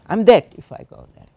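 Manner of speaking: loud, fast, panicked; a frightened girl's loud, rushed thought; panicked inner cry. Retold or imitated. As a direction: blurt it out in sudden terror. Retold panicked thought in latrine.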